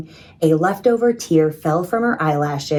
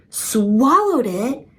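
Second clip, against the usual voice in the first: slow, higher alarmed disbelief; alarmed cry; 6 semitones higher, much slower than usual. A narrator's reading. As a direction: stretch it out in horror. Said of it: "Maria cried".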